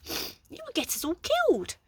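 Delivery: high fretful gentleman; panicked Flabbergast. A narrator's reading.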